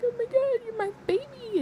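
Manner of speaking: very high-pitched mock weepy squeal; retold tearful gushing. Mother tearing up over her birthday boy, retold high-pitched gushing. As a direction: gush it way up high, imitating yourself tearing up. Retells tearful reply to son.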